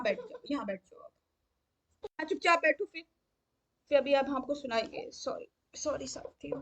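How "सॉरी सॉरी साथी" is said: whispered apology, voice unvoiced. Real, not acted. Apologising to the audience for the child, whispered. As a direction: breathe the sorry without voice. Apologising quietly during interruption.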